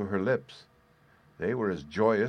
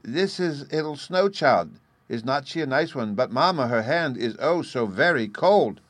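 put-on high, louder, earnest small child; grave little boy; 5 semitones higher, 6 dB louder than usual. A narrator's reading. Little boy tells mamma about the snow child, gravely earnest. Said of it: "with much gravity in his crimson little phiz".

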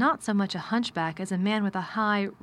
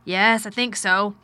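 slow weary man voice; 7 dB louder, slower than usual. Acted. Mr Craven replies.

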